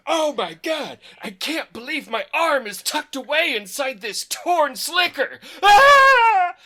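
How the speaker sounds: high shrieking panic, hammed up; mock panicked scream. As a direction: squeal it like a terrified wimp. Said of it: "he screams constantly".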